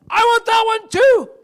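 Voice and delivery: high, loud snatching shout; mock shout. Preacher retells a woman claiming the washer, shouting high. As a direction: shout it like you grab the machine first. Woman grabbing the laundry machine.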